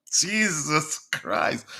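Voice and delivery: slow, drawn-out sigh of frustration; exasperated groan. Real, not acted. Host giving up on decoding a viewer's text, exasperated. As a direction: drag it out in disbelief. Exasperated at hard-to-read comment.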